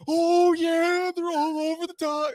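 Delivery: imitating a friend gloating over a big catch; mock gloating. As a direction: rub it in with a gleeful high call. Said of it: "Andy's just like".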